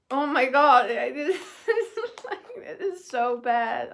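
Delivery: loud outburst breaking into laughter; laughing in dismay. Real, not acted. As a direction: laugh helplessly through the complaint. Laughing in dismay at bad food.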